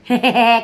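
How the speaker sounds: short burst of laughter; amused laugh. Real, not acted. Streamer mocking a visit to the grave, amused laugh. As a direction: crack up briefly.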